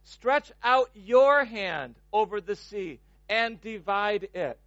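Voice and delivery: slow, weighty verse; slow reading. Shown as a demonstration. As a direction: lay each word down slowly. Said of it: "Notice how he words this".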